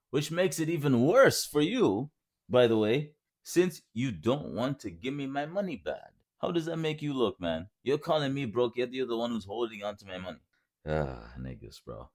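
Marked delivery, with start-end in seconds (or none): 0.1-8.0 heated rant. Real, not acted. Ranting to the scammer about being called broke, heated.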